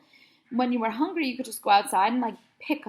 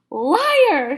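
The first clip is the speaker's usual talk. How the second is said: loud accusing shout; 7 semitones higher, 11 dB louder than usual. A narrator's reading. Officer accusing the boy, loud shout. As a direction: yell liar at him. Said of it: "shouted Officer Spence".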